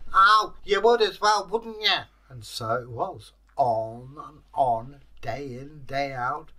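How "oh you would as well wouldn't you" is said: high, loud creature retort; loud, high-voiced grumbling; aggrieved Kelpie. A narrator's reading. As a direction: answer the bully in a high-pitched protest. Kelpie answers the threat.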